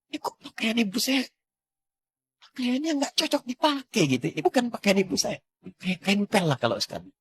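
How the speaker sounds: high, stunned disbelief at mother's clothes; shocked. Retold or imitated. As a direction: cry out in shock, pitched high. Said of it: "kaget saya". (terkejut, suara tinggi tak percaya)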